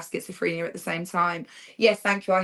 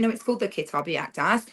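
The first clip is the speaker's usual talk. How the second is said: normal talking voice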